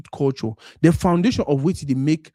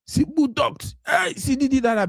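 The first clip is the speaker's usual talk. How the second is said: mock ogling; 9 semitones higher, slower than usual. Man ogling a woman.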